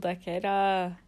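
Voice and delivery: rough Australian attempt; Australian accent. Friend echoing her co-host, attempted Australian accent. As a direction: echo it with a put-on Australian accent. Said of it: "My accents are shit".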